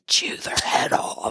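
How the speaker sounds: slow, low creature hiss; praying mantis voice. Acted. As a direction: whisper it like a hungry insect. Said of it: "That was my praying mantis voice".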